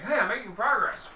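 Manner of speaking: high, loud mock-pleased voice; cheerful player quote. Game master voices the digger boasting of headway, mock cheer. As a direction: pipe up, pleased with yourself. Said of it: "you're like".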